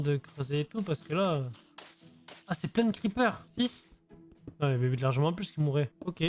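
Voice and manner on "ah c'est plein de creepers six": surprised. Real, not acted. Sudden creepers appear.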